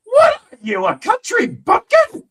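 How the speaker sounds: imitated high-pitched, incredulous shout; loud, slowed, hammed-up squawk of disbelief; drunken outburst. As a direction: yell it like a sauced old mobster heckling. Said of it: "sauced as one could get".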